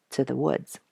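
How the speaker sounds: usual speaking voice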